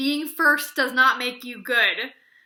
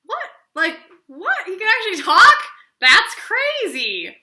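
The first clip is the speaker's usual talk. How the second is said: high, loud, stretched-out astonishment; astonished reader; 8 semitones higher, 11 dB louder than usual. Retold or imitated. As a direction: act out stunned disbelief as a reader. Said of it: "And I was like, as a reader".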